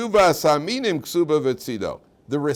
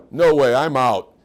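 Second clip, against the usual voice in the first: mock five-year-old; 8 dB louder, slower than usual. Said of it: "the five-year-old says".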